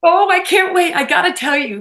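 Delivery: can't hold the story back a second longer; excited eagerness. Real, not acted. Guest dying to tell host how they met, excited eagerness.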